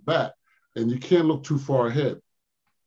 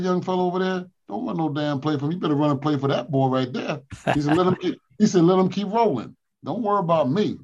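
higher imitation of the Iceman; Iceman's voice; 5 semitones higher than usual. Iceman telling coach to play for the rookie, higher voice. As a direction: give the star's blessing, pitched up.

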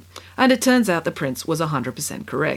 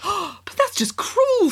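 much higher, slow, aghast; appalled; 14 semitones higher, much slower than usual. Acted. Queen to prince, slow appalled protest. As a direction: draw it out in disbelief.

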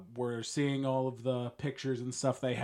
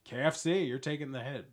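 normal talking voice

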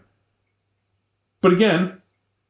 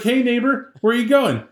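higher, chummy call to a neighbor; friendly owl greeting; 11 semitones higher than usual. Retold or imitated. Owl calls down to the lonely wolf, high and chummy. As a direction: sing out a friendly hello from the tree.

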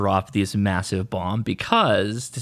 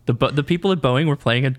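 quick aside; 5 semitones higher, faster than usual. Real, not acted. Fast aside.

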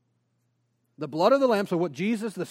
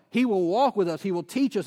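normal talking voice

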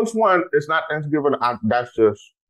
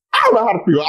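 excited; 10 semitones higher, 4 dB louder, faster than usual. Real, not acted. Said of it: "I'm excited".

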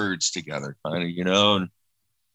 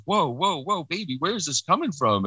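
startled concern; 9 semitones higher than usual. Retold or imitated. Husband startled by wife's words.

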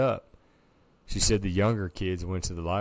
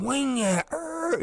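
high squealing tire noise, rising sharply; playful high-pitched skid sound; imitated tire squeal; 3 dB louder, much slower than usual. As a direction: mimic a car screeching to a stop. Car speeds up and stops.

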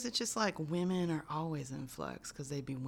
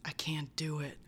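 ordinary talk, nothing marked

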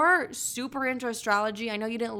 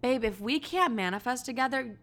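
ordinary talk, nothing marked

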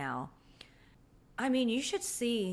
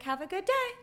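high, bright sing-song send-off; a sugary, overly sweet farewell; chipper goodbye; 11 semitones higher than usual. Retold or imitated. Waving off Ken as he walks away, sing-song and high.

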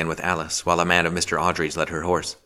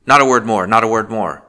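loud, higher, harsh outcry; cried harshly; 4 semitones higher, 8 dB louder than usual. A narrator's reading. The magistrate silences her with a harsh cry. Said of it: "he cried harshly".

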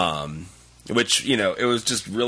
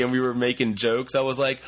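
normal talking voice

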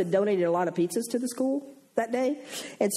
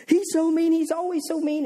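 put-on whiny child; 9 semitones higher, 3 dB louder than usual. Upset girl complaining to teacher.

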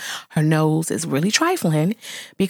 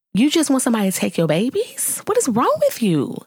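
usual speaking voice

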